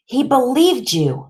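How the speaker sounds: high-pitched, hurt reproach; upset accusation. A narrator's reading. Grieving boy to the doctor, upset accusation. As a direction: accuse her, voice pushed up high.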